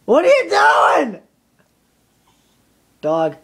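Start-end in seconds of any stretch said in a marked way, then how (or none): 0.1-1.2 higher, loud call at the dog; shouted dog-talk. Real, not acted. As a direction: yell it playfully at the pet. Louder again at the dog.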